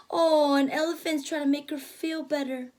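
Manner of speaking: plain reading voice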